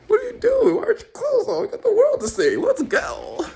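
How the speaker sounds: squeaky, tearful-sounding mock rant; high-pitched exasperation. Acted child urging mom on.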